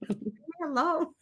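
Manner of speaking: high, drawn-out mock complaint; exasperated protest. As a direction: push back at a pest, half laughing. Protesting to the pestering spirit.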